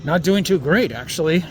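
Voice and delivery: muffled behind a mask; talking through a face mask. Real, not acted. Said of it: "I'm talking through this mask".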